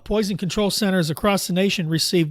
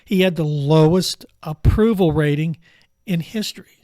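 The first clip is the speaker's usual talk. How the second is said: slow and emphatic, louder on "lowest approval rating"; speaking slowly; 5 dB louder than usual. Shown as a demonstration. Host slows down to drive the point home to doubters, slow and deliberate. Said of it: "I'll speak slowly".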